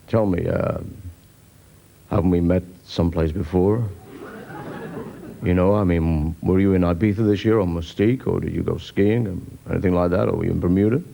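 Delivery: very low smooth chat-up croon; gravel-voiced lothario. Pub lothario chatting up a lone girl, very low croon. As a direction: purr the cheesy line down deep. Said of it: "and he says".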